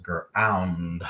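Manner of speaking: "Ground" split as "gr-ow-nd"; slow phonics "Ground" with a clear nd; last sound brought out. Shown as a demonstration. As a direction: stretch "Ground", lingering on the final nd. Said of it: "what do we hear last?".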